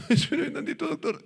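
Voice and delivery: very high, thin patient voice, half laughing; mock weak patient. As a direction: plead feebly as a comic patient. Acted weak patient answering doctor.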